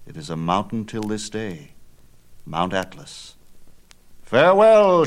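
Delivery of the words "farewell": high, loud parting cry; shouted farewell. A narrator's reading. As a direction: call it out to the nymphs as he flies off. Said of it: "shouted Perseus".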